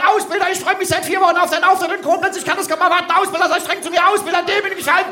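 high, fast gushing fan cry; loud fan shout. Retold or imitated. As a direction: rattle it off like an over-eager fan. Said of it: "rufst ganz laut".

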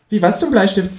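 high-pitched kangaroo character. Acted. Kangaroo's line in story.

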